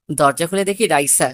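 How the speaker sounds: ordinary delivery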